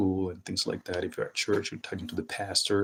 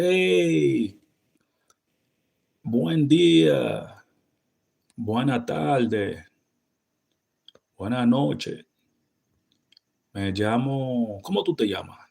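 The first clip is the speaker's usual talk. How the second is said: high, loud, drawn-out Dominican greeting; Dominican Spanish; 5 semitones higher, 5 dB louder, slower than usual. Shown as a demonstration. Greeting someone new, relaxed Dominican Spanish. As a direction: stretch it out, casual island style. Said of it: "I say".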